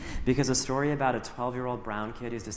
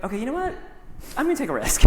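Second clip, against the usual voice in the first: 1 nervous resolve; 13 semitones higher, 4 dB louder, much faster than usual. Retold or imitated. Deciding to call on a kid.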